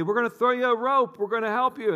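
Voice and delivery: high, loud shout from the helicopter; megaphone call. Acted. Helicopter crew over megaphone.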